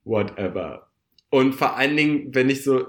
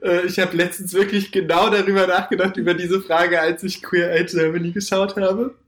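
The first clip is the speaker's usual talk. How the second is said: higher, amused and lively; 6 semitones higher, 3 dB louder than usual. Real, not acted. Host amused by her co-host's makeover question. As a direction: tell it still giggling at the question.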